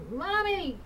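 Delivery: put-on a small child calling faintly for her mother; little girl calling. Ghostly little girl calls for mommy from afar, high and soft. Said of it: "I'd hear her say".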